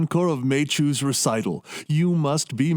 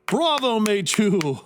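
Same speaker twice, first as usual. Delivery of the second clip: high-pitched cry of praise, falling away; delighted bravo; 6 semitones higher than usual. Acted. Host applauding his assistant's piano recital, delighted. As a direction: cheer the performer with real relish.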